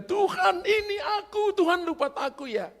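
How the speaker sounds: high complaining plea to God; whiny plea. Retold or imitated. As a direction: whine up high like a neglected child. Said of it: "Kita bilang". (rengekan tinggi kepada Tuhan)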